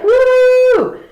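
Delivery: high, loud whoop of overexcitement; extreme happy cheer. Retold or imitated. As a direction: whoop like joy is over the top. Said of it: "like, way happy and you're like".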